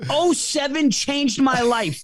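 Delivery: mock his own gushing fan line, slowly; mocking himself as a fanboy. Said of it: "Now he thinks I'm this N F L ner".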